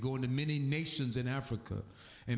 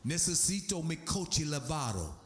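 proper Cuban Spanish; 3 semitones higher than usual. Shown as a demonstration. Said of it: "they wanna be proper when they say it".

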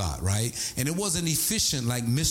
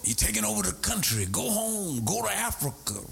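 put-on raised, hostile old man telling him off; mock angry old man, higher voice; old man cursing; 7 semitones higher than usual. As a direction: throw the insult like the bitter old man did. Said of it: "he was using profanity at me".

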